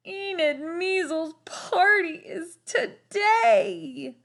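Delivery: high, slow crying wail. A narrator's reading. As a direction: sob it out in despair. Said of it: "wailed Lester".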